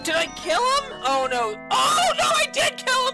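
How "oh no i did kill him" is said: high, loud shock; shocked cry. Real, not acted. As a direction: cry out in disbelief.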